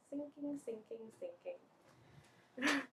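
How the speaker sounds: slow, soft, fading repetition; hushed sinking. Retold or imitated. Student shows desks sliding down, hushed sinking. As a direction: let each word sink away softly.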